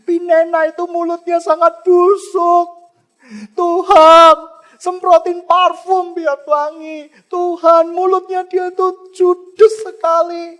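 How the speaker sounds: mock prayer shouted high so the rival hears; hammed-up loud prayer, slow at the start; loud showy prayer. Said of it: "Tidak ngomong dengan kata-kata keras supaya didengar". (doa pura-pura yang diteriakkan keras dan tinggi supaya madunya dengar)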